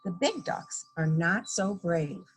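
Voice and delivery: ordinary delivery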